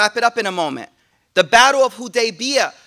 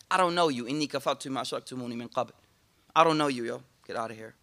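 cold dismissal; 10 semitones lower, 10 dB quieter, much faster than usual. Acted. Shaitan disowns people on judgment day.

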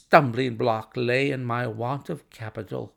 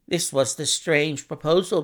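ordinary delivery

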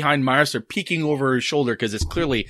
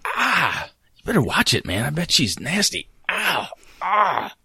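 under his breath; 3 semitones higher, 5 dB quieter than usual. Acted. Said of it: "under his breath".

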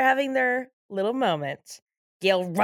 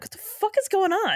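startled; 10 semitones higher than usual. Retold or imitated. Voicing two startled witnesses.

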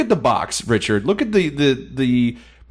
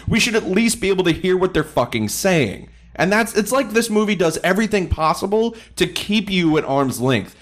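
raised pitch, fed-up protest; exasperated rant; 6 semitones higher than usual. Real, not acted. Critic fed up with inaudible dialogue, exasperated. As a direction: complain hotly, voice riding high.

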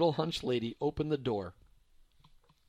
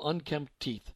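usual speaking voice

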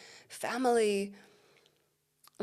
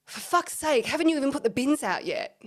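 higher, louder mock nagging outburst; exasperated snapping; 5 semitones higher, 5 dB louder than usual. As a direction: act out snapping at your partner in irritation. Acting out snapping at partner.

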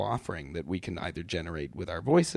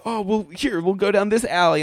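high, sing-song guide voice; playful guide; 10 semitones higher, 4 dB louder than usual. Retold or imitated. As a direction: lead them on like a tour guide. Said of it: "and it's like".

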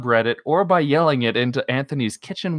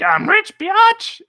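gleeful cheer; 4 dB louder, slower than usual. Retold or imitated. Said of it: "they were like".

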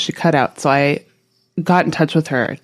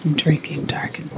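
whispering; 6 dB quieter than usual. Acted. Joins spooky whisper.

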